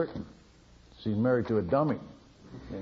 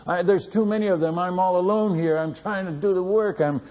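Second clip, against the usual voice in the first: crying out to God; 6 semitones higher than usual. Retold or imitated. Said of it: "And I'm crying out to God".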